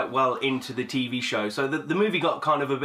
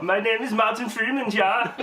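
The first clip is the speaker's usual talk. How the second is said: higher, laughing fake German accent; mock German accent; 10 semitones higher, 3 dB louder than usual. Joking that Freeman's accent sounds German, in mock German accent. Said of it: "I thought it was German".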